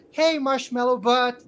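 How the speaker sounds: loud, high mocking call; funny kid jeering. Funny kid teasing the fallen bully, loud jeer. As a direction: heckle him across the camp. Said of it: "which is, like, the funny kid".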